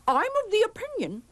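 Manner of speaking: high, quick put-on official voice; principal. A narrator's reading. Principal warning the teacher about impostors, official. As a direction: rattle it off as a stuffy principal. Principal figure makes pompous statement.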